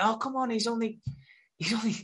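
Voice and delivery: high-pitched imitated pleading; mock coaxing. Friends persuading Harold to attend the party, mock coaxing. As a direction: talk him round in a high, put-on voice.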